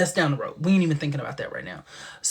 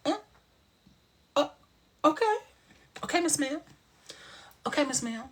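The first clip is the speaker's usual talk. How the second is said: meek okay; 10 semitones higher, 4 dB quieter, faster than usual. Retold or imitated. Speaker's meek reply to child.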